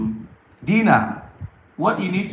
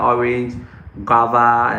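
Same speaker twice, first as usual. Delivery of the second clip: normal talking voice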